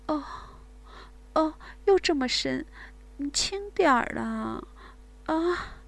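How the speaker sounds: high, breathy moans and pleas; moaning woman. Acted. Woman to her lover, high breathy moaning. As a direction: gasp and moan, overwhelmed.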